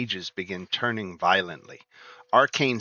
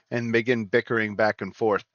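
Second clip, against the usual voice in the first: ordinary talk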